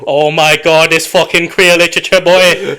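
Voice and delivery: loud, high, over-the-top relish; hammed-up thrilled enthusiast; mock really into it. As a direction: gush with exaggerated excitement, near laughing. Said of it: "like you're really into it".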